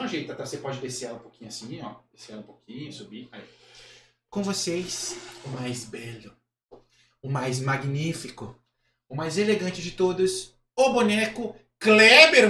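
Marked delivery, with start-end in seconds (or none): 4.3-12.5 grand, drawn-out stage introduction; show announcer. Acted. Host presenting the puppet to the audience, grand announcer. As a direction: build slowly to a loud, stretched name.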